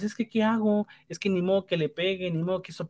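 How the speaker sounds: mock a lost parent pleading; helpless parent. A parent asked about discipline, whining helplessly. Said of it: "Y tú le preguntas".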